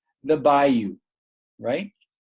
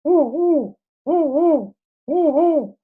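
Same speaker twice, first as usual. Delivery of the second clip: high, hollow owl hoots in pairs; high-pitched mimicked owl call; imitated owl hoot; 13 semitones higher, 4 dB louder, slower than usual. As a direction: hoot like a great horned owl. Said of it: "I heard the owl".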